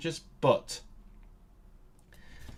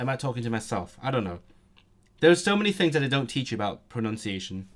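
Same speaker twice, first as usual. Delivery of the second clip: ordinary delivery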